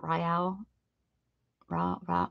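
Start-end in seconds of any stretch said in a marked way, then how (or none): 0.0-0.6 "Raul" said like "RYE-al"; wrong pronunciation of Raul. Shown as a demonstration. Streamer showing how not to say it, "Raul" as "RYE-al". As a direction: open "Raul" with a "rye" sound. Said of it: "It's not Raul".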